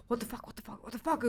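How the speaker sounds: breathless under-the-breath panic; panicked muttering. Retold or imitated. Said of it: "I'm panicking".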